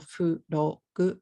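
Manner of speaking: "fu, ro, gu" in three even beats; katakana English. Shown as a demonstration. Teacher showing the wrong syllable count, katakana English. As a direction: FROG split Japanese-style with added vowels. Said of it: "if you say it in katakana".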